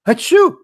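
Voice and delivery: a loud, high, comic sneeze; mock sneeze. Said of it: "he said".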